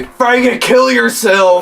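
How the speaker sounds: imitated yell. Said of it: "hillbillies call me".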